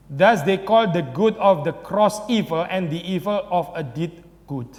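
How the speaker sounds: slow, deliberate reading of an English quote; slow quotation reading. Acted. Preacher reading Luther's thesis to his congregation, slow and deliberate. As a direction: read the old text out slowly, weighing each word.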